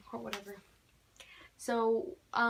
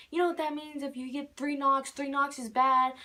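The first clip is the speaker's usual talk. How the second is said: high, louder panicked warning; freaking out; 5 semitones higher, 6 dB louder than usual. Retold or imitated. Warning the parents about three knocks, panicked and loud. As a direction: warn them in a rising panic. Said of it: "I started freaking out".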